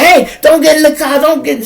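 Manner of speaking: high, loud cop yell; imitated shout. Cop shouting at the woman to stay out, high loud yell.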